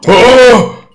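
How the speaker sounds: high, loud frightened yelp; sudden giant shriek; startled giant scream. Acted. Cyclops startled.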